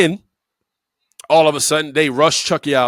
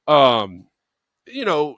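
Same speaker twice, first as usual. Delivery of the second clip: normal talking voice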